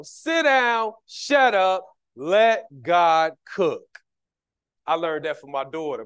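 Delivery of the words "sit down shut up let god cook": sit down, shut up, let God cook, each pressed hard; slow, loud, drawn out final let God cook; preacher punching the command; slow emphatic command. Shown as a demonstration. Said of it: "That's what I'm telling you".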